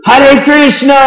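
high, loud friendly greeting shout; calling out. Retold or imitated. Toll booth worker leaning out, calling to him loudly. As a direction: hail him from several booths away.

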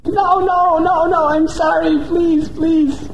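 high, frightened begging; terrified pleading. Acted. Dacoit leader begging the goddess to spare him, terrified. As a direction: plead for your life in terror.

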